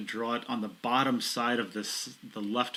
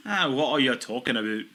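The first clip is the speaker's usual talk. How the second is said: loud hammed-up Scottish-ish accent, pitched up; mock Scottish accent; 3 semitones higher, 7 dB louder than usual. Commentator answering co-host's teasing in a mock Scottish accent. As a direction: bluster in a Cockney Scot voice. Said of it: "it's a Cockney Scot".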